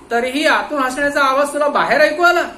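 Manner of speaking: loud, high interrogator's voice; stern questioning. Acted. Inspector grilling the watchman, loud and stern. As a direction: press him hard like a cop.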